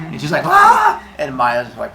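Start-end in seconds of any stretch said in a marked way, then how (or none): 0.5-1.0 high, loud shriek; mock scream on being jolted awake; sudden pitched-up yelp; startled scream. Woman kicked awake.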